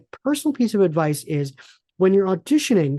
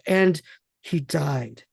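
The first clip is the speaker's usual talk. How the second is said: mock dramatic; much slower than usual. Auditioner closing on a really dramatic note, mock dramatic. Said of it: "end on a really dramatic note".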